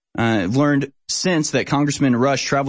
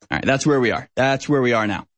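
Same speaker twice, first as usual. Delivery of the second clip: ordinary talk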